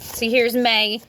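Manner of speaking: ordinary delivery, nothing marked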